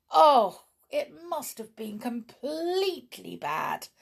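high, drawn-out dismay; distressed. A narrator's reading. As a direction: open on a loud OH, then lament.

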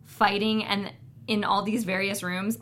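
normal talking voice

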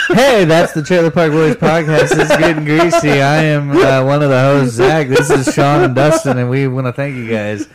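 loud, high hammed-up radio host; podcast announcer voice. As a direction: boom it like a show intro. Mock podcast intro voice.

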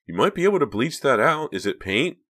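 lightly voiced concerned secretary; Josie. A narrator's reading.

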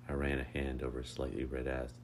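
ordinary delivery

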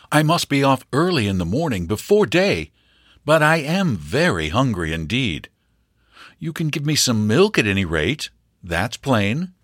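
breezy request for a bed; lightly voiced young hunter; easygoing young man. Stranger asks for milk.

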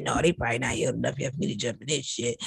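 deep low answering voice; low mock voice. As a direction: drop way down and answer yourself.

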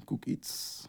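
breathy unvoiced aside; hushed playful whisper. Real, not acted. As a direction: whisper the words under breath.

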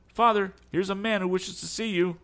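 high, loud call to father. A narrator's reading. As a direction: call across the shop to your dad. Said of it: "he called".

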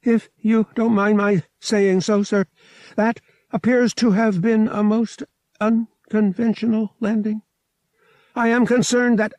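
prim droid, a bit rattled; shaken droid. A narrator's reading. Droid answering pilot after crash, shaken droid voice.

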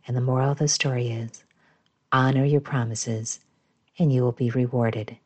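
usual speaking voice

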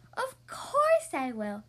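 slow, drawn-out, eager yes; emphatic agreement. A narrator's reading. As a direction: stretch it out with emphasis.

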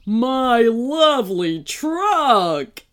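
whining. A narrator's reading. Man getting up beside his crashed truck, whining. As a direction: whine over each drawn-out word. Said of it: "he whined as he got to his feet".

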